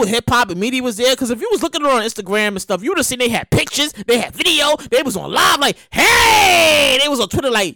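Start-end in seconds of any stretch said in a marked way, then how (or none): 5.9-7.0 a loud hype call from the crowd; hyped shout. Retold or imitated. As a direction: shout it like a hype post from the party. Hype posts from the stadium.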